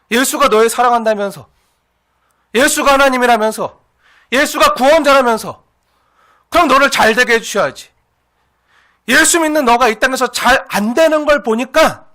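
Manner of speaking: loud, insistent taunting, phrase after phrase; acted mocking accusation. Scoffers deriding suffering believers, loud and accusing. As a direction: throw their beliefs back at them forcefully. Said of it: "참수하고 조롱하고 비난하는".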